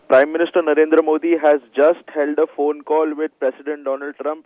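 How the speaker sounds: loud, urgent headline read; breaking news announcement. Real, not acted. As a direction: punch it out like a breaking news alert.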